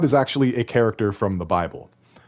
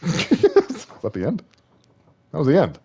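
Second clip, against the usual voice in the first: laughing; 7 semitones higher than usual. Real, not acted. Laughing at abrupt story ending.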